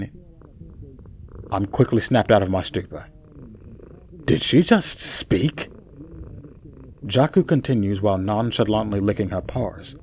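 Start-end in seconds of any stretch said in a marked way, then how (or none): 4.2-5.7 slow, high astonished question; stunned disbelief. A narrator's reading. Cat speaks unexpectedly.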